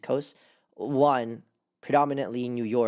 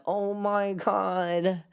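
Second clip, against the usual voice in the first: mock Filipino accent, slow and high; 5 semitones higher, slower than usual. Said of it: "in a Filipino accent come out just now".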